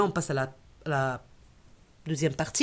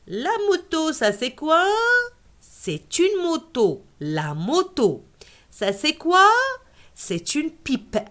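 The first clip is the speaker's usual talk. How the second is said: higher questioning teacher voice; quiz voice; 8 semitones higher than usual. Shown as a demonstration. Teacher quizzing a child on words, higher questioning voice. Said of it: "teacher plays question and answer".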